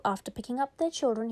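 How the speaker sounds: plain reading voice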